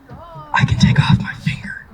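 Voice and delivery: high, slow secretive whisper; mock whisper. Grandpa whispers to the little child, mock secretive.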